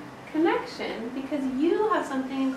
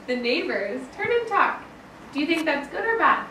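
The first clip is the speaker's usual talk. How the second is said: plain reading voice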